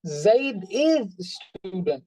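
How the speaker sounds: "is" stretched long and stressed; slow delivery leaning on the word "is"; emphasis on is. Shown as a demonstration. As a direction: insist on "is", drawing it out. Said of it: "you can see I'm giving emphasis".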